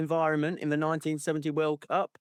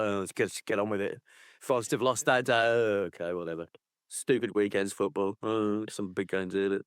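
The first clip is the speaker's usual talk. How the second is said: low, deflated, half-hearted host patter; glum host; 7 semitones lower than usual. Pretend. Pundit after Forest lost, lightly voiced glum host. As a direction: mumble the links like a gutted host. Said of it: "It's like".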